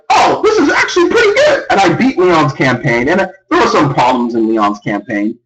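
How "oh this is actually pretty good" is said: pleasantly surprised. Retold or imitated. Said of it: "And I was like".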